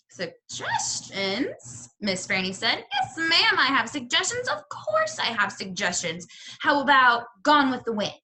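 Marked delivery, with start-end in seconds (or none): 0.1-1.9 high, slow, drawn-out question; surprised echo. Acted. Librarian echoes the girl's request, high and slow. 2.9-8.1 bursting to recommend her favourite; eager librarian. Acted. Librarian eagerly suggests a book to the girl, high and keen.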